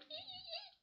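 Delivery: ricanement démoniaque très aigu; rire démoniaque. Retold or imitated. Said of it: "Tu sais, un rire démoniaque". (rire de démon, aigu et inquiétant)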